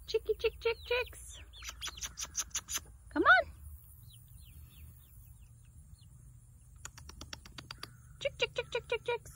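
high sing-song chick chick; calling chickens. Real, not acted. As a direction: lilt it up high to the flock.

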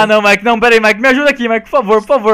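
mock pleading. Begging Mike for help with the drawing, loud mock plea. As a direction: plead like it's an emergency.

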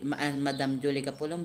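ordinary talk, nothing marked

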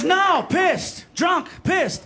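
high-pitched, punchy local drinker; emphatic correction. Retold or imitated. Australian at the bar corrects an American, high and emphatic. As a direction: punch each word to set the foreigner straight. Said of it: "He goes".

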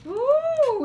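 squealed ooh, high and loud; a sharp delighted ooh. Real, not acted. Reacts to the sour face.

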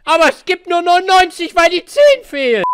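loud, high furious rant; angry gamer rant. Real, not acted. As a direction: rage loudly at the game. Raging at the game, shouting. (wütender Gamer-Ausraster)

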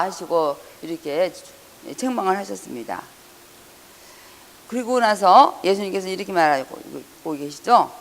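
ordinary delivery

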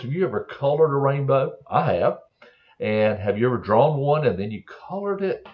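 plain reading voice

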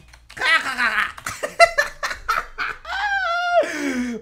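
long, loud, high-pitched cackle; carcajada burlona. Acted. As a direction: shriek with gloating laughter. Se ríe de su propio insulto. (carcajada aguda y escandalosa)

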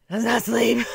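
growling demon voice, punched and loud; demonic voice. Pretend. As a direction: let a demon speak through you. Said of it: "sorry about the demonic voice".

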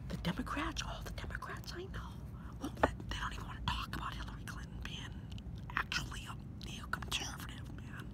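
secretive hushed whisper. Acted. Conspiratorial whisper.

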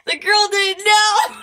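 high, slow shout between laughs; loud hysterical shout. Real, not acted. Vlogger shouting about the girl amid laughter, high and loud. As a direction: shout it out mid laughing fit.

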